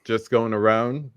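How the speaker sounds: ordinary talk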